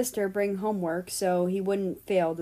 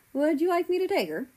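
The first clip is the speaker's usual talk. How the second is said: higher voiced dad, a touch of a different man; lightly voiced father; 9 semitones higher, faster than usual. Father checking on son about sister, lightly voiced higher.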